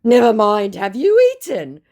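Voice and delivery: awkward clumsy pivot; floundering scammer. Retold or imitated. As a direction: scammer out of things to say, high pitched. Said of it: "running out of conversation".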